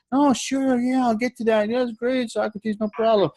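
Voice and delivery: mock breezy upline; obliging upline. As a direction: sound helpful and breezy. Acting upline agreeing.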